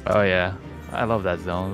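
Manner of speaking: normal talking voice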